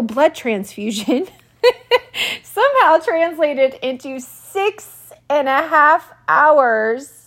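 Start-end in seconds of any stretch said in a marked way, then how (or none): 1.6-2.4 high, short disbelieving laugh burst; incredulous laugh. Real, not acted. Laughs at the long ER wait. 2.4-7.2 incredulous. Real, not acted. Disbelief at the six and a half hour wait.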